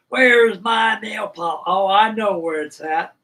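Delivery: mock mother asking loud and slow; high, loud, drawn-out mom voice; mom demanding. Retelling his mother hunting for her nail polish, loud and slow. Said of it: "Boy, she goes".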